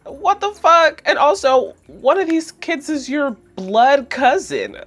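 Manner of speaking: high, loud incredulous rant; high, loud outraged rant; outraged disbelief. Real, not acted. Outraged recap of Angelica.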